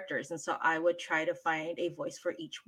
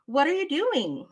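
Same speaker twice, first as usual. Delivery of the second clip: put-on higher, louder mother questioning a child; 8 semitones higher, 6 dB louder than usual. Shown as a demonstration. Mother asks her kid what they are doing, put-on mother voice. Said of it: "Let's do an English mother first".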